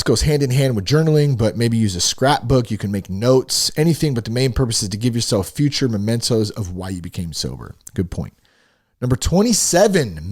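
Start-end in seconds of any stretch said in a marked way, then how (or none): none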